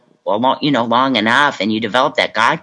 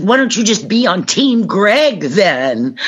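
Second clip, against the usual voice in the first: higher, slow and huffy; mock huffy snap; 8 semitones higher, slower than usual. Wife snapping at her sponsor before storming away. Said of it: "I said".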